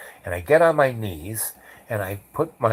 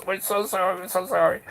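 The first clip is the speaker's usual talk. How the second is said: high, small squirming voice; imitating a man squirming as he apologises; squirming apology; 9 semitones higher, faster than usual. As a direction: squeak out a repeated, embarrassed apology.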